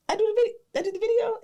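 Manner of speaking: nervous fan pleading; high wobbly self-introduction; eager squeaky plea. Retold or imitated. Telling star about her video.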